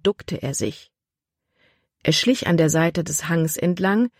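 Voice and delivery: ordinary delivery, nothing marked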